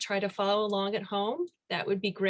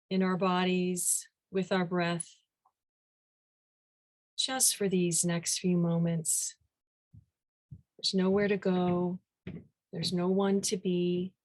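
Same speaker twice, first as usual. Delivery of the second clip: guided meditation. Real, not acted. Teacher leading the group into meditation, unhurried guided-meditation delivery. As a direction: ease them gently into stillness, phrase by phrase.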